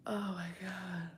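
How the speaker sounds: slow, hushed whisper. Real, not acted. Whispering to herself in shock. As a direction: breathe it out under your breath. Reacting alone on stream.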